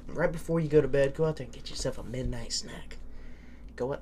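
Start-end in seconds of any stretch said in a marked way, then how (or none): 1.2-4.0 smooth radio host read. Shown as a demonstration. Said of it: "I sound like a radio host there".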